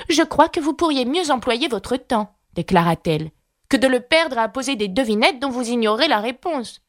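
voix de fillette plus aiguë, un peu lasse; Alice lasse. A narrator's reading. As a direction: reprocher poliment, avec lassitude. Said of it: "un soupir de lassitude". (Alice, voix aiguë, lasse)

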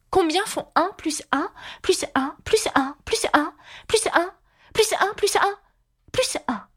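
high queen reeling off a sum. A narrator's reading. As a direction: rattle off each item in a high, rhythmic voice.

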